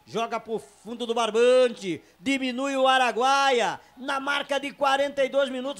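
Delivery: shouted, stretched goal call; explosive goal commentary. Real, not acted. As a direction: erupt into the goal call, drawing out every word.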